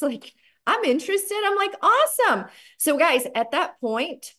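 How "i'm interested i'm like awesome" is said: high-pitched keen exchange; eager. Retold or imitated. Prospect saying yes and seller delighted, high and eager. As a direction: pipe up brightly, much higher than usual.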